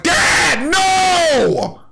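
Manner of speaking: high, loud desperate cry of dad; hammed-up son screaming for his father; yelling at dad in a high strained voice; mock anguished Trunks yell. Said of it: "Trunks got mad and was like".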